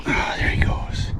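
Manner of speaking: hushed whisper. Real, not acted. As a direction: whisper as he swims off. Watching a released fish swim away.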